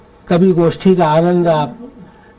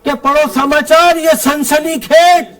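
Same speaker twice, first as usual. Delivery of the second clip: higher, measured stage verse with ringing line ends; comic poem recitation; 6 semitones higher than usual. Acted. Poet reciting a police satire to a festival audience, declaimed comic verse.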